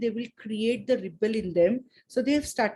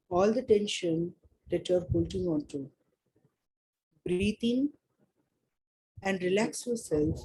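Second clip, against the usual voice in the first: calm guiding voice, easing them in; guided meditation. Acted. Leads a meditation.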